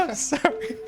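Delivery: laughing. Real, not acted. Apologizing while still laughing.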